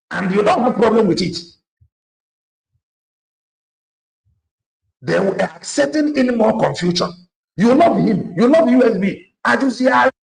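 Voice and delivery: high, loud scolding rant; angry shouting. Real, not acted. Speaker scolding followers, loud angry shout. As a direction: shout them down in outrage. Scolding listeners who love USB.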